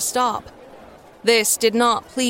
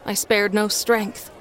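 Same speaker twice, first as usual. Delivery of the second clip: ordinary delivery, nothing marked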